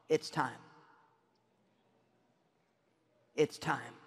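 low, soft, tender firmness; sweet but strong. Retold or imitated. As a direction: say it softly and low, sweetly sure. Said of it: "so sweetly but so strongly".

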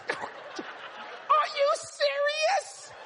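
very high, drawn-out squeal; overcome disbelief. Retold or imitated. Young worker asking about the hundred dollars, squealed disbelief. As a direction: mimic stunned joy, stretched right out. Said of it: "they get spiritual".

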